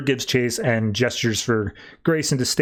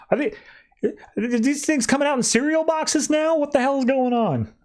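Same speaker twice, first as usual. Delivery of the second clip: pitched way up in mock disbelief; high, incredulous, like nothing makes sense; squeaky baffled question; 13 semitones higher than usual. [name] baffled by new heroes.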